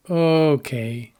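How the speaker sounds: "okay" with a long drawn-out O; lengthened first syllable. Shown as a demonstration. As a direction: stretch the start of "okay". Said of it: "pronounced with different duration".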